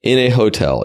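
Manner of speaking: normal talking voice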